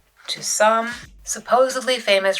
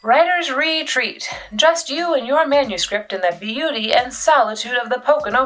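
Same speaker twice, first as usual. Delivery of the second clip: ad read; 6 semitones higher than usual. Acted. Reading parents' online ad.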